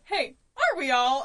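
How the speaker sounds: mock-casual comeback; joking reassurance. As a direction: make light of her panic. Joking reply to panicking hiker.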